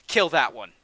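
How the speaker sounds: loud curt command to kill; barked order. Acted. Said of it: "and he says".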